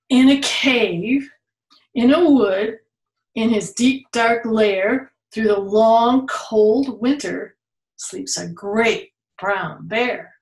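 put-on reading to children. Reading the bear book aloud to a child, bold and rhythmic. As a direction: roll out the rhyme for a small listener.

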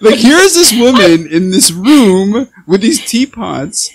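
loud, high and drawn out through laughter; amused amazement. Real, not acted. Host laughs about the guest's room full of teapots, laughing amazement. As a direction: marvel loudly while laughing, stretching the words.

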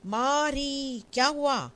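high, loud anguished cry; loud scream. Acted. Uma seeing the three bodies in the next room, loud anguished cry. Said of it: "जोर की चीख मारती है".

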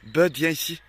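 calling the dog. Real, not acted. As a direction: call the dog over. (appel plus fort au chien)

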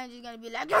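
ordinary talk, nothing marked

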